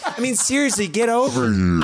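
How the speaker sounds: laughing Scorpion catchphrase impression. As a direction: whine it like a needy Scorpion. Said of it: "this is what Scorpion's voice sounds like".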